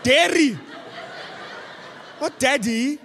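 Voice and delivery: mock English cartoon-kid accent, high and bright with laughter; imitating daughter's Peppa Pig accent. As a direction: play the daughter copying Peppa Pig, giggling. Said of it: "she takes on the accents of the characters".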